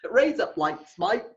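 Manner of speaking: put-on playful broad Australian accent; hammed-up Aussie "razor blades"; deep Australian accent. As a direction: run the words together like an Australian. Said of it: "That's a deep Australian accent".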